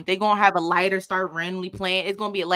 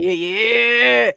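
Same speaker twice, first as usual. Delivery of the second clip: louder, knowing slow yeah yeah; DC man's slick yeah; 3 semitones higher, 8 dB louder than usual. Retold or imitated. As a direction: drop it like a smooth DC guy. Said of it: "when they hit you with the "yeah".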